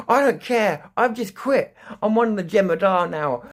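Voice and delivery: high, loud mock-villain declaration; hammed-up defiant shout; manic rogue captain. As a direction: yell it like a captain gone rogue.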